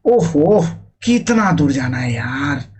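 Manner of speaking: slow, drawn-out "ओहो", exasperated; worn out by a long walk; tired complaint. Shown as a demonstration. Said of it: "अगर थक गए". (थकान से झुंझलाते हुए)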